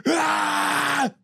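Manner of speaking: high, loud wordless shout of fury; mock rage scream. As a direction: let out an imitated furious roar. End of imitated rage rant.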